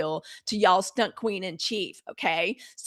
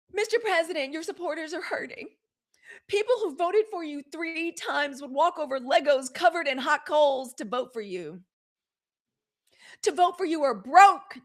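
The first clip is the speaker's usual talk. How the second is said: higher, hammed-up earnest plea to the president; mock pleading pundit; 6 semitones higher than usual. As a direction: perform her dramatic appeal, mocking. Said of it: "This is a quote".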